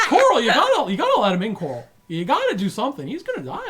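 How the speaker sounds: high, loud, frantic; he's gonna die, panic; panicked alien. Acted. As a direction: alien guard shouting in laughing panic. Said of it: "he's like".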